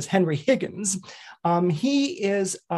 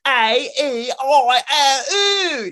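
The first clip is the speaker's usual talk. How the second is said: slow, loud, high wailing vowels; mangled Cockney vowels; 10 semitones higher, 7 dB louder, much slower than usual. Shown as a demonstration. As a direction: wail them out like a street girl. Flower girl mangling vowels.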